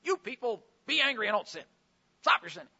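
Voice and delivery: loud, abrupt mock telling-off; mock yelling. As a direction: yell it at them as the wrong reading. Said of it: "I don't think he's yelling at them".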